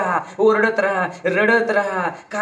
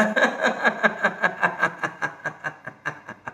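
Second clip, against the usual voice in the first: long rhythmic laugh, fading away; released laughter; 6 dB quieter than usual. Shown as a demonstration. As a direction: let the breath out as a long staccato laugh. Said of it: "श्वास घ्या आणि सोडा". (श्वास सोडत लांब हास्य)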